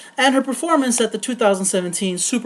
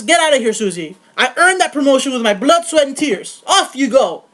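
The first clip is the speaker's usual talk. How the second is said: higher, louder, hammed-up outraged refusal; mock indignant protest; 5 semitones higher, 4 dB louder than usual. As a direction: fend off a pushy boss with theatrical panic. Acts out refusing a harassing boss.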